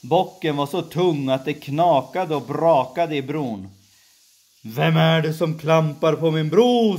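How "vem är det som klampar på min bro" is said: louder, higher troll demand; troll's shout. Acted. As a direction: roar it from under the bridge. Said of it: "skrek trollet". (trollet skriker högt, högre tonläge)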